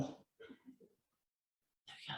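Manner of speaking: whispered aside under the breath. Real, not acted. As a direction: breathe it to yourself. Aside at last slide.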